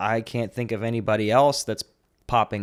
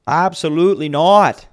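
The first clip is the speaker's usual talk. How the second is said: put-on Canadian accent; 7 semitones higher, 7 dB louder than usual. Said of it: "the accent I cannot do".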